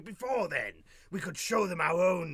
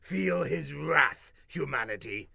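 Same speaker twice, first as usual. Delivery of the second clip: slow menacing; 4 semitones lower, slower than usual. Acted. Ominous proclamation.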